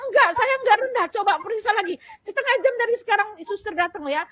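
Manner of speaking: high, loud refusal, then firm request; insistent objection. Retold or imitated. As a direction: push back and demand a recheck. Patient disputes low blood pressure.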